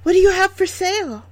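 put-on very high, girlish voice; young girl. A narrator's reading. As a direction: ask like a curious little girl.

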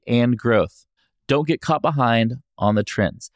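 smooth, measured sponsor read. Acted. Host reads a sponsor message, ad voice. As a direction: read the ad like a radio spot.

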